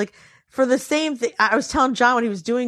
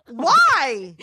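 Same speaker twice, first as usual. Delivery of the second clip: puzzled; 4 semitones higher than usual. Retold or imitated. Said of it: "And I go".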